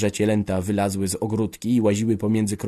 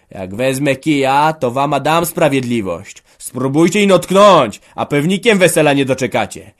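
menacing shout; 5 semitones higher, 10 dB louder than usual. Acted. Family members threaten each other in quarrel, shouting. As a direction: hammer each threat home at full voice.

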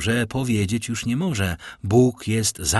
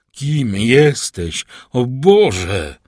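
loud, drawn-out demand; desperate shout; 2 semitones higher, 8 dB louder, much slower than usual. Acted. Villefort demanding his enemy's name, desperate shout. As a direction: cry out in horror, slowly and loudly.

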